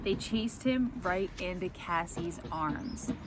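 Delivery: usual speaking voice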